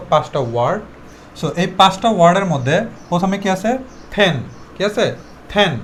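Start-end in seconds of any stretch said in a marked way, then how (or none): 4.1-4.4 ফ্যান with a puff of air on the opening P; aspirated P. Shown as a demonstration. Teacher models the first word for students, aspirated P. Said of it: "আমরা ফ উচ্চারণ করব". 5.5-5.8 ফ্যান opening on a puffed P; aspirated P. Shown as a demonstration. Teacher repeats the answer for students, aspirated P. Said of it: "আমরা ফ উচ্চারণ করব".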